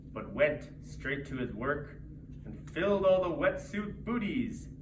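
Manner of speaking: plain reading voice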